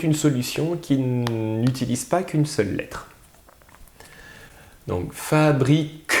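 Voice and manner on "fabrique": "fabrique" slowly in syllables, ending on a bare k; sounded-out word. Shown as a demonstration. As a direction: separate "fabrique" and release the k alone. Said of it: "Qu'est-ce qu'on entend tout à la fin".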